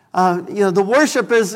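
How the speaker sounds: ordinary delivery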